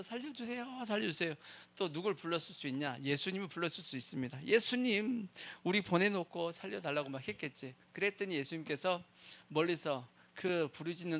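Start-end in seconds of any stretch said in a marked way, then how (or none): 0.0-1.3 mock cry for help. Disciples screaming for help. 4.5-7.1 mock calling out. Disciples calling Jesus.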